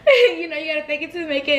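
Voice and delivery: laughing through the motto. Real, not acted. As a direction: giggle it out, fast and high.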